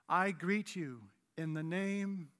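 slow, solemn churchy formality, dropping away softly; mock-reverent, ceremonious delivery; archaic pious greeting. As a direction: read it like a letter from ancient times. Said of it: "No, we don't talk like that".

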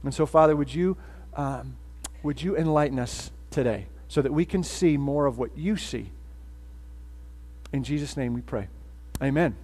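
quiet, reverent prayer voice. Acted. Pastor praying to God, quiet and reverent. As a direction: softly lead the church in prayer.

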